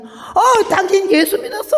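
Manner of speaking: high, laughing exclaim; nun suddenly grasping she believes; surprised realisation. Retold or imitated. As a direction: catch on with a delighted laugh.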